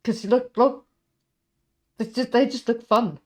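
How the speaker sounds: excited henchman. Acted. Vinny thrilled to be praised by his boss, loud and eager. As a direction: gush to the boss like a thrilled lackey. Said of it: "Vinny looks like".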